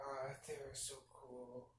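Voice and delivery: awed whisper to yourself. Retold or imitated. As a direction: breathe it out in a starstruck whisper. Fan whispering after celebrities leave.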